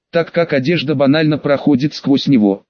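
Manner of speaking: usual speaking voice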